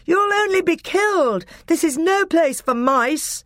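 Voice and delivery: very high exasperated warning call; shout. A narrator's reading. Peter warning the mice off the battlefield, high shout. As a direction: shout a warning across the fighting. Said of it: "shouted Peter".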